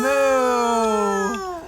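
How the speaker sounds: a long, drawn-out mooing cow. Acted. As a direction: moo like a big cow for the child.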